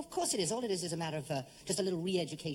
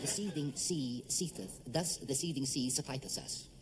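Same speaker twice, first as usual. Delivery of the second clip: slow careful diction; 2 semitones lower, slower than usual. Shown as a demonstration. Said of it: "demo repeat".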